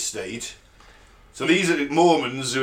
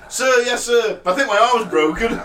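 recruit voice; 8 semitones higher, 5 dB louder than usual. Retold or imitated. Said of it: "He's like".

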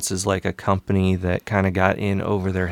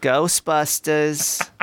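slow, higher Brooklyn drawl on the title; Brooklyn secretary accent; 7 semitones higher, much slower than usual. Shown as a demonstration. Showing her Janine Brooklyn voice, slow and higher. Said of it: "I love doing that sort of accent".

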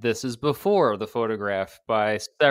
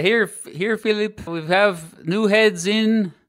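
bad, slow, higher French accent; horrible French accent; 8 semitones higher, 4 dB louder, slower than usual. Historian voices revolutionaries delivering heads in a bad French accent. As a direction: ham up a clumsy French accent. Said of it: "Horrible French accent".

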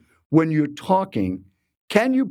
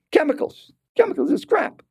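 very high, indignant repeated dismissal; scornful rant. Retold or imitated. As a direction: imitate a disgusted stranger, pitched way up. Retelling seatmate's scornful food rant.

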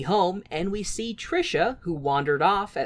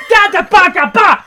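loud, high, spluttering nonsense syllables; outraged sputtering; 13 semitones higher, 14 dB louder than usual. Real, not acted. Fan sputtering at the cartoon, loud and high. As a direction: sputter like you're too outraged for words.

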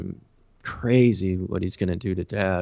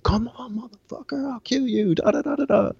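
mock crazed yelling; 13 semitones higher than usual. Inmate banging on booth glass at officer, mock frenzied yell. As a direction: imitate screaming through glass without the full volume. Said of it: "I'm going crazy".

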